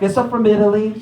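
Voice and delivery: mock Italian-immigrant grandmother voice; heavy Italian accent. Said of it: "a very heavy Italian accent".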